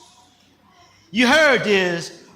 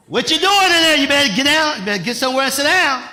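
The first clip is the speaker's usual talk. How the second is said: loud, higher mock parent yelling into the next room; parent calling out; 4 semitones higher, 4 dB louder, faster than usual. Parent yelling at a child in another room, loud and stern. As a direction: call out to the kid like a stern mama.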